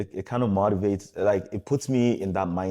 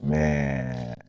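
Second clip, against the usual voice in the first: ordinary talk, nothing marked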